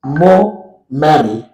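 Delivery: teacher slowly spelling out the wrong form; slow wrong example. Shown as a demonstration. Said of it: "it is wrong for us to say".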